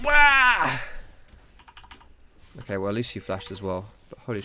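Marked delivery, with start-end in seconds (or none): 0.0-0.8 sudden high yelp of alarm; a sharp, high, loud cry of surprise; startled yelp. Real, not acted.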